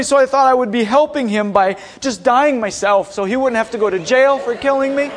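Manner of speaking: ordinary talk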